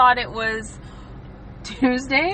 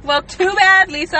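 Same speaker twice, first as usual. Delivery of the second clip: sassy retort; 7 semitones higher, 8 dB louder than usual. Acted. Playful retort to friend.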